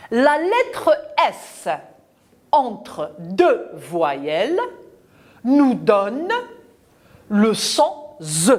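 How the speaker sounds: put-on slow, spaced rule for the children to repeat; teacher spells out the rule word by word; slow dictation. Shown as a demonstration. Teacher dictates the rule slowly to the class. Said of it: "je retiens quoi?".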